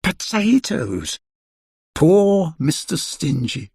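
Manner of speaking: plain reading voice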